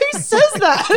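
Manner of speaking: high, laughing who says that; giggly retold question; laughing disbelief. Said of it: "I was like".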